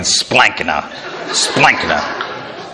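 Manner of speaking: splankna said low and slow, like soup dropping into the gut; splankna savoured. Shown as a demonstration. Preacher savouring the Greek word for the congregation, low and slow. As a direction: let splankna sink down low and plop. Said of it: "You can hear the food, your soup just dropping in".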